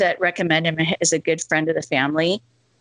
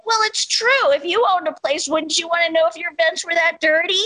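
mom's high-pitched insistent retort; imitated indignant protest, voice way up; indignant self-defence; 9 semitones higher than usual. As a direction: argue back as an unrepentant mother.

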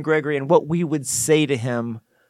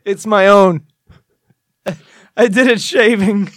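embattled coach; 5 semitones higher, 5 dB louder than usual. Acted. Plays embattled coach denying a killing.

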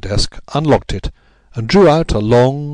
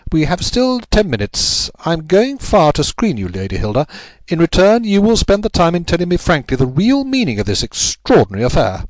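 higher-pitched detective laying down terms; Holmes, firm and brisk; 8 semitones higher than usual. A narrator's reading. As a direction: set out the bargain firmly.

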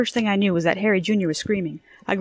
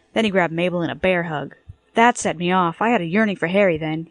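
ordinary delivery

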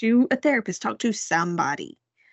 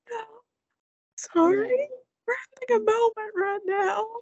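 high, tearful mock wail; imitated crying; 13 semitones higher than usual. Crying mom holding off husband, imitated tearful wail. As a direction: act out tearfully refusing through sobs. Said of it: "I just started crying".

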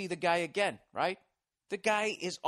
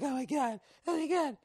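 high, breathless mock panic; 8 semitones higher than usual. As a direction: squeal like a nervous passenger. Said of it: "you start freaking out like".

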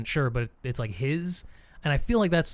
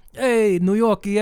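put-on slow, loud New York accent, higher; 7 semitones higher, 6 dB louder than usual. Describing Walken's sound, a hammed-up New York accent. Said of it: "a very New Yorkie accent".

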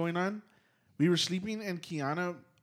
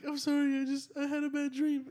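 high-pitched, small dreamer's voice; imitated apologetic partner; 12 semitones higher than usual. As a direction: mimic someone just woken, apologising in a high voice. Voices the partner after her nightmare.

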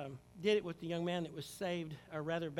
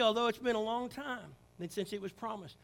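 usual speaking voice

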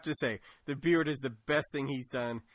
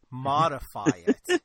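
air quotes; 10 dB louder than usual. Retold or imitated. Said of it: "him do my air quotes again".

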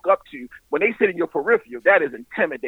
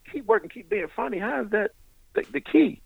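higher, softer mock indignation; mad, incredulous; 6 semitones higher, 5 dB quieter than usual. Comic recalls resenting his idol's advice, mildly mad. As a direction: retell it as a put-out, disbelieving question. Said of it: "And I was mad. I'm like".

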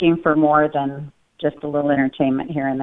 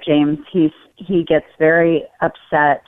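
ordinary talk

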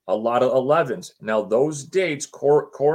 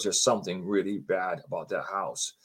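low, hushed ominous quote; grave retold warning; 6 semitones lower, 7 dB quieter than usual. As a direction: drop low and soft, warning them. Retelling woman's grave warning.

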